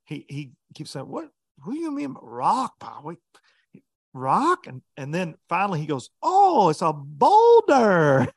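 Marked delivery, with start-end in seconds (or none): 1.1-4.7 put-on puzzled, higher foreign-accented query; confused Japanese accent. Japanese angler voiced, confused. 6.2-8.3 drawn-out, higher, louder dawning; slow Japanese-accented realization. Retold or imitated. Japanese angler voiced, realizing.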